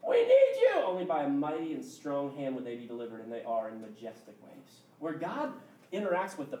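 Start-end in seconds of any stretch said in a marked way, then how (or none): 0.1-0.8 high, loud desperate plea; crying out. Retold or imitated. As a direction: cry out to God, begging, high and loud. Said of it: "They're crying out".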